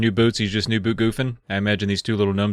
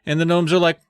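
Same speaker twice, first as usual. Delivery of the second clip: usual speaking voice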